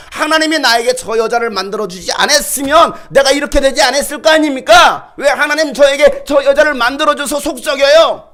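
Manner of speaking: loud, high protest flung at God; indignant shout. Retold or imitated. Adam shouts his complaint to God, indignant and loud. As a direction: let Adam yell his grievance upward.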